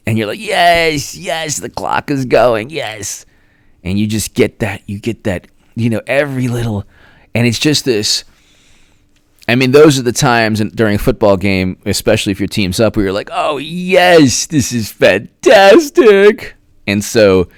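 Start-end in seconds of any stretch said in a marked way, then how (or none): 0.4-3.3 mild fan cheer. Retold or imitated. Fan cheering his team. 13.3-16.6 high, slow, loud gloating; football fan gloating as the clock runs down; imitating a gleeful fan. Fan cheering his team.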